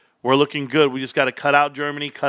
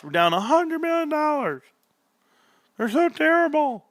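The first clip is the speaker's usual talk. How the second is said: excited; 13 semitones higher than usual. Real, not acted. Mocking glee at losses.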